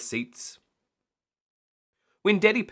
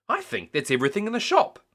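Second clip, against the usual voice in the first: higher and fast, shopkeeper voice; Miss Rabbit; 10 semitones higher, faster than usual. A narrator's reading. Miss Rabbit teasing about the bought-out shop, higher and fast. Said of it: "said Miss Rabbit".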